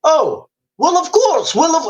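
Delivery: high-pitched mock Russian reply; Russian official. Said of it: "do you think the Russians might say".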